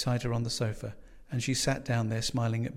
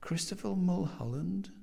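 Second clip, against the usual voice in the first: higher, tentative questioning name; searching memory; 7 semitones higher than usual. A narrator's reading. Young man trying to recall a guest's name, questioning. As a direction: test the name, lifting into a question. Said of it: "searching his memory".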